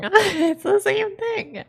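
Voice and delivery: high, drawn-out laughing exasperation; squealing through laughter; laughing protest. Real, not acted. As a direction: crack up while insisting, voice shooting up. Laughing in disbelief, repeating herself.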